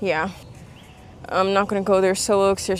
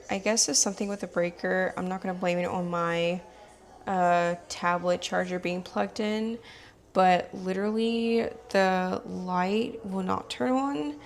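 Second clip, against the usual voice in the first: softer hushed late-night talk; quiet; 8 dB quieter than usual. Real, not acted. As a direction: keep it down, people nearby. Talking late at night in the dark.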